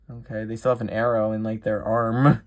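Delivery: normal talking voice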